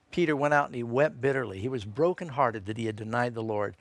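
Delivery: ordinary talk, nothing marked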